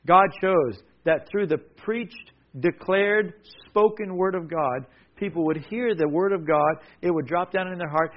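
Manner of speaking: normal talking voice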